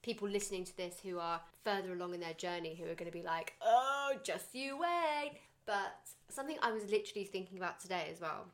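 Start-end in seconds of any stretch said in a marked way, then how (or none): 3.6-5.3 high, loud, drawn-out knowing taunt; exaggerated singsong caution; mock warning. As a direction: imitate smug parents, slow and high. Mimicking knowing, warning parents.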